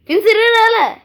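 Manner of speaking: indignant demand. Real, not acted. Demanding his missing bed.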